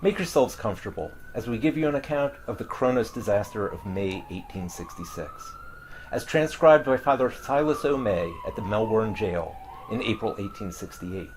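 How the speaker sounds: usual speaking voice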